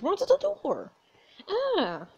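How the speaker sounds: high, panicked urging. Real, not acted. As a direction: urge it in panic.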